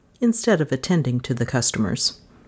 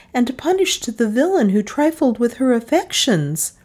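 higher, lively wifely lecturing; a bride talking her husband down, brightly and higher; wife scolding her new husband, higher and brisk; Mrs Bullfrog voice; 7 semitones higher than usual. A narrator's reading. Wife voice continues.

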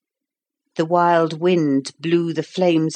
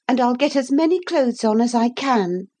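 put-on high, earnest young girl; a girl reassuring herself in the flood; little girl voice; 8 semitones higher than usual. A narrator's reading. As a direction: speak up as a sensible child. Narrator voicing Lucy.

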